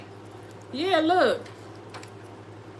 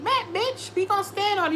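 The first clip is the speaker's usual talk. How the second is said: much higher, defiant bravado; mock bold vow; 9 semitones higher than usual. Reader voicing the querent's defiant reply, high and cocky. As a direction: toss it off like a dare.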